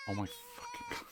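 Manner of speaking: high, soft exasperation; exasperated groan. Real, not acted. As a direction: sigh it out in disbelief. Frustrated at puzzle.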